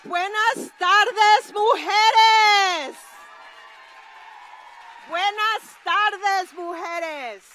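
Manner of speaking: high, loud, slow Spanish greeting; rally greeting. Real, not acted. Director greeting the women at the rally, high loud call.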